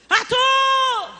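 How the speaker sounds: high, long holler of a name; loud mother's call, stretched out. Retold or imitated. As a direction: shout for a child across the house. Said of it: "tô gritando muito".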